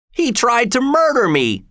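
high, outraged convict; accusing convict. Acted. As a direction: cry out the accusation.

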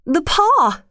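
high, urgent narrated cry; wild cry. A narrator's reading. Wife waking her husband with a sudden cry, wild. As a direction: cry out suddenly, pitch leaping up. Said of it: "she cried wildly".

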